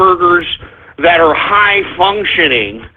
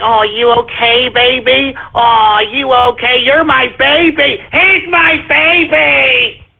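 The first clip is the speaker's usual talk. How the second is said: slow, exaggerated baby-talk, climbing higher; mock a mother fussing over her darling; coddling mommy; 4 semitones higher, slower than usual. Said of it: "mommy has probably coddled your ass".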